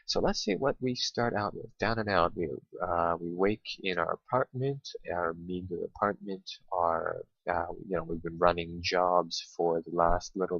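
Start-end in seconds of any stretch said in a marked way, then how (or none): none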